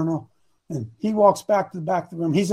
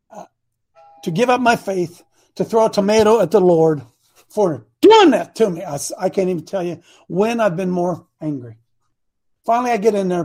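ordinary talk

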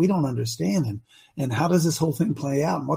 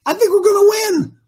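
rising, louder excited declaration; 13 semitones higher, 6 dB louder than usual. Real, not acted. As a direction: burst out with growing excitement. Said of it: "I was excited".

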